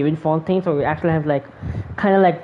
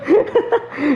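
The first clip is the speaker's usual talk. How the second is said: short laugh; 7 semitones higher than usual. Real, not acted. Laughs at game line.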